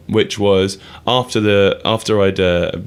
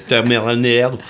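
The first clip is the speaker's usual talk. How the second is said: put-on French accent; 3 semitones higher than usual. Host redoes the band's line for his co-host, French accent. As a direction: lean harder into the French accent on "tomorrow night". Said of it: "better at your French".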